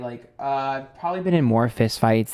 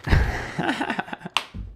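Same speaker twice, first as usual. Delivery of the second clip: high breathy laugh; 11 semitones higher, 5 dB quieter than usual. Real, not acted. Joking dodge of accent request.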